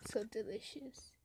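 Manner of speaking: hushed whispered praise. Real, not acted. Cook whispering about the tasty dish. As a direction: whisper it as a secret.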